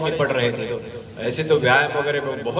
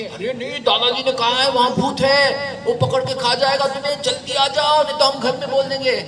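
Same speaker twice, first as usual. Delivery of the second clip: put-on much higher, anxious little boy; pleading child; 10 semitones higher than usual. Scared friends beg Narendra to come down, high-pitched.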